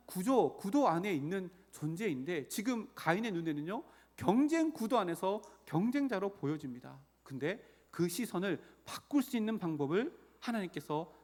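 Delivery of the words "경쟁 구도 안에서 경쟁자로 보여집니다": ordinary delivery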